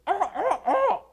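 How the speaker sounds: very high yelping monkey hoots, half laughing; monkey alarm call. Retold or imitated. Lecturer imitates a monkey's eagle alarm, high yelps. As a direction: yelp like a vervet spotting an eagle. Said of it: "makes his call".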